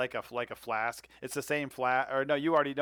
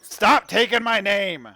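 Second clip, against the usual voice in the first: slow, loud, higher indignant yell; offended boatman yelling down into the water; indignant scolding; 8 semitones higher, 8 dB louder, slower than usual. Acted. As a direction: drag each word out at the rival. Pete scolds rival in water.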